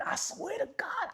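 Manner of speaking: very high, whispery squeak; high hushed squeal of insistence; squeaky oath. Real, not acted. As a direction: swear it in a tiny airy falsetto.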